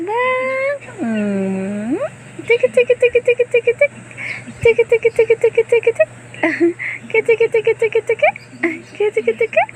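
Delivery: high squeaky tiki chant. Real, not acted. High chant to amuse baby.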